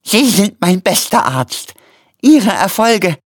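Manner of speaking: high, slow plea of a wounded man; flehend. A narrator's reading. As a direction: plead slowly, strained and high. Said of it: "flehte Quillipi". (hoch, langsam und flehend)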